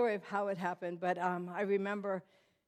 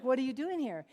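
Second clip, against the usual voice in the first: higher, forceful retold demand; confronting demand; 4 semitones higher, 3 dB louder than usual. Woman grabbing the surgeon in a drug house, confronting him. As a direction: demand an answer as you grab him. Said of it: "I just grabbed him".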